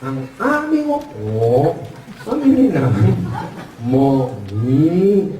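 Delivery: slow, low ngongo voice; nasal-speech customer. Acted. Ngongo customer repeating the order to the father, muffled nasal speech. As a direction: stretch out the garbled words. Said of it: "ngongo din".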